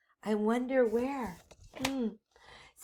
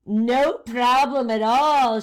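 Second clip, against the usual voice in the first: sly witch; 9 dB louder, slower than usual. Acted. Witch agrees, plotting.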